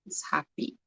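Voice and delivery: whispered softly while writing; whispered to self. Real, not acted. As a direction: breathe it under your breath.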